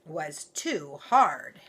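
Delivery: slow, weighty storybook reading; slow and big. A narrator's reading. Narrator reading the great big bed line slowly and heavily. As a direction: drag it out to show how big.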